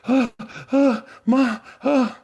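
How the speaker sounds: high, loud choked sobs through the words; hysterical sobbing. Retold or imitated. Son sobbing to his mom at her door. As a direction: sob out the word Ma, unable to speak. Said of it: "I was just hysterically crying".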